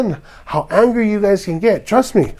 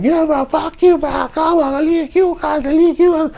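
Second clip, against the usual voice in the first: much higher taunting squeak; mock skinny idiot; 10 semitones higher than usual. Vlogger mocks the skinny idiot's taunts, high and squeaky. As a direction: play him as a shrill pipsqueak. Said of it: "The skinny idiot starts going".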